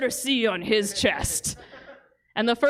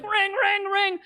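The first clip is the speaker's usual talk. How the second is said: high mock bike bell; hammed-up bell-ringing voice; 9 semitones higher than usual. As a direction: ring it high like a little bell. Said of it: "Gord's like".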